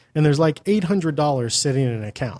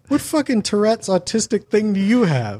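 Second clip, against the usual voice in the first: incredulous mock; 8 semitones higher than usual. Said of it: "And I'm like".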